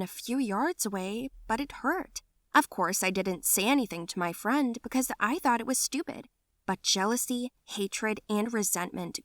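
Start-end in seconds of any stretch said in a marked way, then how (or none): none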